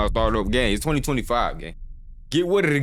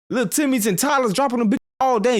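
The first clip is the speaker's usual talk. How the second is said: excited; 10 semitones higher than usual. Real, not acted. Hyped rant.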